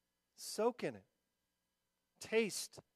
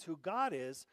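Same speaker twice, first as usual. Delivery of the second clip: normal talking voice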